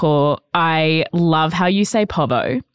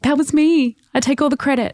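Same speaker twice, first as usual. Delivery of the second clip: higher-pitched gleeful claim of credit; mock bragging, pitched up; proud boasting; 7 semitones higher than usual. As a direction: crow it like you own it. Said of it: "I'm gonna be like".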